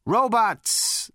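brash, swaggering space rogue; cocky Zaphod. A narrator's reading. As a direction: sneer it at the robot. Zaphod's character voice.

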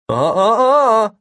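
high, loud sing-song tut-tut; scolding. A narrator's reading. Kid interrupting the agent to correct him, scolding. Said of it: "scolding Agent Miller".

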